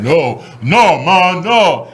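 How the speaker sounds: a mock deep manly denial. Son to mom, faking a deep manly voice. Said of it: "I had to put on my deep voice".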